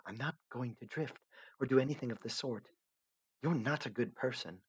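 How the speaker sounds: lightly voiced young heroine, gently insistent; Aradia, softly puzzled. Aradia lost in the void, pleading softly to the narrator.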